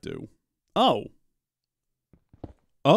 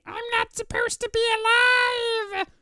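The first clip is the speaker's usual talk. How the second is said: mock rock scream. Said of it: "gonna start screaming".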